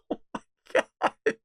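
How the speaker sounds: breathless, high laugh through the words; incredulous laughing. Real, not acted. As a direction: laugh helplessly as you say it, drawn out. Laughs at what was read.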